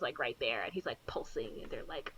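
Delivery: spooky whisper; unvoiced suspense whisper. Real, not acted. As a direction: hush it like a scary story. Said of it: "spooky storytelling hush".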